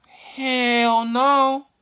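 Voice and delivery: high, loud, drawn-out outburst; emphatic refusal. Retold or imitated. Kid refusing to go outside, loud and high. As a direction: wail it out as a big refusal.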